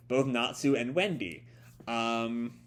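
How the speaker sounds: ordinary delivery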